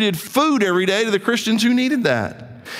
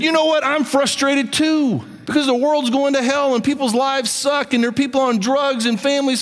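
raised, driving sermon voice, higher; impassioned preaching; 5 semitones higher than usual. Acted. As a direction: preach it hard, building urgency. Preaching at rising force.

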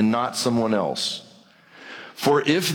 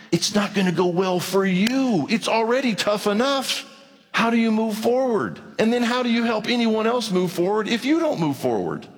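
urgent, pitched-up plea to keep moving forward; earnest raised pleading; 8 semitones higher than usual. Retold or imitated. Pastor urging an angry listener to move forward, high pleading voice. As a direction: press the question home, voice lifted high. Pastor voicing his counsel to someone.

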